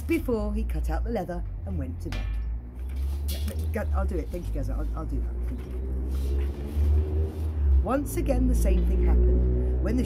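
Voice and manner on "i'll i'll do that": ordinary delivery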